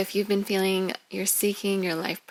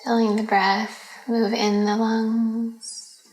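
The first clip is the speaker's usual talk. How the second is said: guided meditation; 3 semitones higher, 5 dB louder than usual. Acted. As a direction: guide them gently into relaxation, phrase by phrase.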